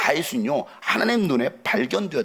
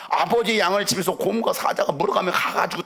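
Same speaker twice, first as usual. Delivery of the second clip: ordinary delivery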